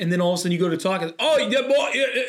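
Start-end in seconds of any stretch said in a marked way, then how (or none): 1.2-2.2 mock Aussie accent. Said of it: "Australian accents and British accents".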